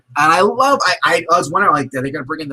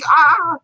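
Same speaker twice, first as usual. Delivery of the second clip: pained yell; 5 dB louder than usual. Retold or imitated. Man hit in the groin.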